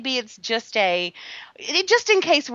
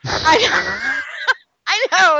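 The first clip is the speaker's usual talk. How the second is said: loud, high, crying with laughter; can't stop laughing at herself; laughing hard; 6 semitones higher, 7 dB louder, slower than usual. Real, not acted. Host admitting her accent was bad, laughing hard.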